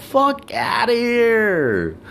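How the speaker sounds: very high, very slow scoff; mock-incredulous inner scoff; dismissive. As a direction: drag it out high in disbelief. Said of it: "thinking to myself".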